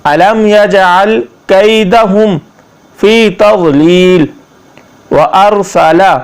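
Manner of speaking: slow word-by-word tajweed; slow Arabic recitation for learners; Quranic recitation lesson. Shown as a demonstration. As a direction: draw out the long vowels, pause after each phrase. Models the verse.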